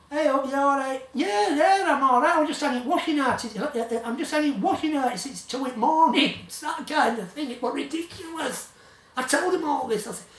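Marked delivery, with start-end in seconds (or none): 0.1-6.3 lightly voiced mate, higher and louder; voicing Paul. Narrator voicing his colleague on a late-night call, a higher mock chirpy voice. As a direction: play the colleague chirpy on the phone.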